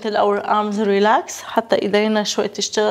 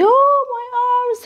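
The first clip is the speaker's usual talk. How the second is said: straining groan; slower than usual. Real, not acted. Strained mid chest press set.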